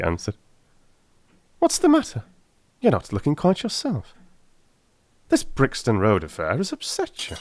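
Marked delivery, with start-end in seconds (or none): 1.6-7.4 higher, lively voice for Holmes, enthusing about music; a keen detective carried away by his idea. A narrator's reading. Holmes notices Watson upset.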